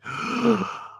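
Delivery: sharp, high mock gasp; Jason's gasp. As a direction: imitate a friend's horrified intake of breath. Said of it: "hear the gasp in Jason's voice".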